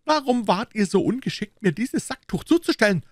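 very high, indignant musketeer voice; indignant accusation. A narrator's reading. Aramis accusing the Gascon over the cloth, indignant.

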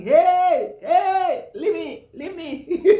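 high, loud cries of a fed-up bag; handbag shouting. Pretend. Old handbag shouting at its owner, loud mock yell. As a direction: yell like a worn-out bag begging to be left. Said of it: "the handbag will be shouting".

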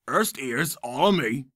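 thick slurred Scottish-ish burr; thick mumbled accent. Retold or imitated. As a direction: swallow the consonants, thick accent. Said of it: "his accent was so thick it was more like".

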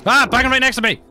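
loud, very high cry of alarm; high-pitched shout at a sudden noise; startled yell. Real, not acted. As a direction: yelp it out as you are startled. Sudden banging beside the player.

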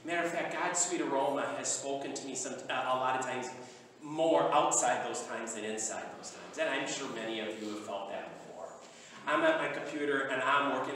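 hushed heartfelt testimony; soft sincere. Real, not acted. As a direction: draw them in quietly and sincerely. Soft intimate preaching.

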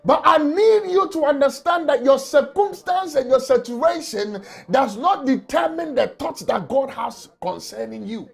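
ordinary talk, nothing marked

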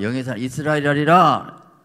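이스라엘이라 ringing out loud with a long fall; proclaiming. Acted. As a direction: proclaim the new name to the hall. Renaming proclaimed. (이스라엘이라를 크게 선포하듯)

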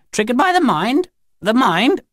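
high, rising alien voice; questioning Grinny. A narrator's reading. Grinny shrunk down in her big chair, high and questioning.

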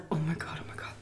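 barely audible voiceless whisper; frightened whisper. Retold or imitated. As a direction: whisper it to yourself in mock dread. Retold fright as woman approaches.

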